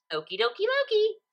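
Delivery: high, bright chirp; chirpy. A narrator's reading. Pinkie chirping as she takes the chalk. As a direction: chirp it out happily. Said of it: "Pinkie chirped".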